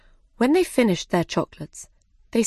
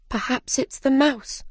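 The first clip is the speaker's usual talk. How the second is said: higher-pitched hopeful thought; Alice thinking to herself; 6 semitones higher than usual. Acted. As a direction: wonder it privately, lightly voiced. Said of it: "she thought".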